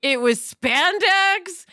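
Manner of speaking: drawn-out, deliberate list of the eighties; slow listing. Real, not acted. Recalling the eighties for the podcast, drawn out slowly. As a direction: savor each word of the list.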